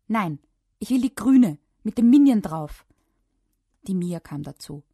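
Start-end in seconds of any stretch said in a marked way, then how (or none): 0.1-2.9 put-on high, very loud child's protest; loud insisting. A narrator's reading. Child refusing the dark blue trunks, loudly insisting. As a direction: dig in stubbornly, voice raised.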